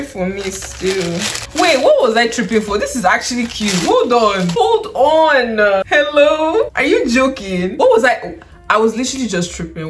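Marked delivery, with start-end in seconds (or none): 1.6-8.4 louder, higher, delighted disbelief; amazed excitement. Real, not acted. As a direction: exclaim in happy shock at how wrong you were. Amazed reversal on seeing the item.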